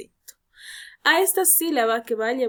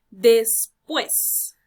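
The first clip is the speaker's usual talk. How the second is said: "Después" split as des... PUÉS, second part punched; stress on last syllable; 5 dB louder than usual. Shown as a demonstration. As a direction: pause inside "Después", then hit PUÉS. ("Después" separado en sílabas, con fuerza en PUÉS)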